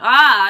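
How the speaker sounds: loud, sudden mock-alarmed "ah"; exclaimed "ah". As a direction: cry out as if catching yourself. Startled at own accent slip.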